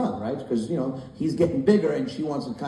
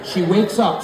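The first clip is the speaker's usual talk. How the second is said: normal talking voice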